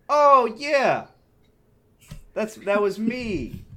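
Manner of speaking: cheeky, drawn-out confession, the second line slow; smug admission. Retold or imitated. Server owning up to the grumpy customer with smug cheek. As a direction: own it with a pleased, knowing drawl.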